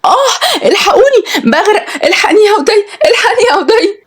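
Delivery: acted panicked shriek without extra volume; screaming for help. A narrator's reading. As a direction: cry out as if going under. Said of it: "وفضلت تصوت".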